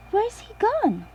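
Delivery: put-on young piglet surprised the snail vanished; Peppa voice. A narrator's reading. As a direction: gasp then ask, high and worried. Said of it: "asks Peppa".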